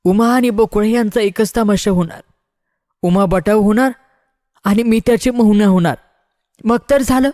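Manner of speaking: high-pitched woman's declaration; Bakula. A narrator's reading. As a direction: step in and announce it, pitched well up. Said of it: "Bakula पुढे होऊन म्हणाली".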